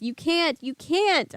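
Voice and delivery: high shriek; panicked high protest. Acted. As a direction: shriek in terror. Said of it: "I shrieked".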